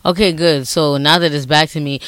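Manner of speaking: ordinary talk, nothing marked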